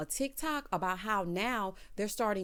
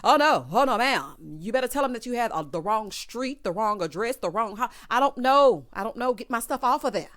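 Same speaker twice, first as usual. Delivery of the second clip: loud, emphatic mock refusal; acted loud protest; 2 semitones higher, 7 dB louder than usual. Refusing to house Maurice, loud mock protest. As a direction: protest loudly, hitting each wrong item.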